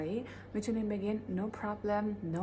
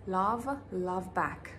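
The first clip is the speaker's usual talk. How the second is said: very slow model; much slower than usual. Shown as a demonstration. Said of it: "این هم همینه".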